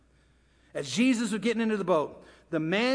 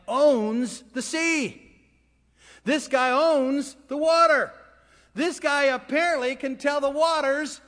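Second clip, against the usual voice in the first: high, drawn-out disbelief; awestruck disciples; 6 semitones higher, 3 dB louder, slower than usual. Acted. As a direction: marvel in stunned amazement, building to a loud question. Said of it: "And they were terrified".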